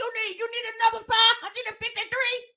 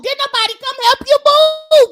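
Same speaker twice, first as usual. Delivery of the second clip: louder, higher demand; loud incredulous question; 6 semitones higher, 8 dB louder than usual. Real, not acted. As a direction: call out the question in disbelief. Challenging her story.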